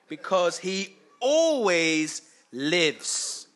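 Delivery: slow, loud drawn-out proclamation; preaching emphasis. Acted. Preacher proclaiming to the congregation, slow and loud. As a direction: lean hard on always, slowly.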